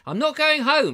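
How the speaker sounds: high, loud mock vow to stay; mock declaration. Retelling his joke to Pauline, loud and playful. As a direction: proclaim it like a greedy guest.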